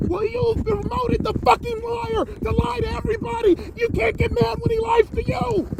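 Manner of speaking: high shouted accusation; furious shout. Real, not acted. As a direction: blast it at her. Berating host for promoting liar.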